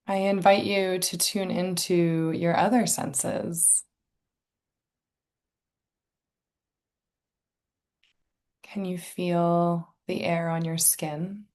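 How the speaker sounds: calm, unhurried meditation guide, long pauses; guided meditation. Real, not acted. Inviting listeners to tune into senses and breath, guided meditation. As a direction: softly guide listeners into relaxation.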